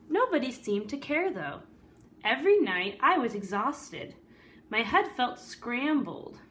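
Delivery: lively read-aloud storytelling voice; story narration. A narrator's reading. Teacher reading a picture book to the class, storyteller voice.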